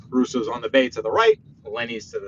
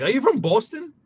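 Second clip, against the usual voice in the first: higher-pitched mimicry of nosy strangers; mock questioning outsiders; 11 semitones higher, 4 dB louder than usual. Out-of-towners hear the New Yorker's accent, mock curious question. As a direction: imitate out-of-towners asking, voice pitched up. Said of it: "people hear my accent and they're like".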